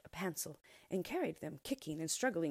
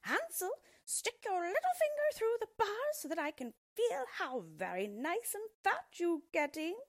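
shrill witch voice; 4 dB louder than usual. A narrator's reading. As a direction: screech it out like a greedy old witch. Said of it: "cried out shrilly".

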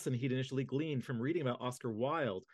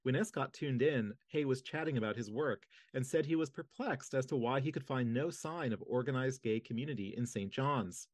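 ordinary talk, nothing marked

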